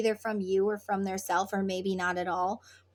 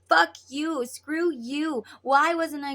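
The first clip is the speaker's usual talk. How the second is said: higher, louder mock rant; negative outburst; 4 semitones higher, 3 dB louder than usual. Acting out negative words to a twin, mock angry. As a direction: hurl the words in mock anger. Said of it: "all these negative words".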